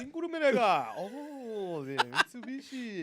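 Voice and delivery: loud mock Japanese gibberish; fake Japanese. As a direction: shout fake Japanese words. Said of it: "Your fake Japanese is really good".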